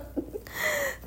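quick high-pitched giggle. Real, not acted. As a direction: let out a light high giggle. Giggle after own remark.

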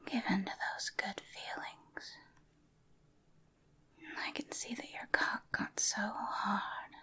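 very soft, breathy ASMR whispering. Acted. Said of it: "I'm going to try A S M R with you".

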